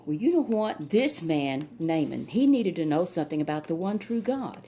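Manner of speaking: usual speaking voice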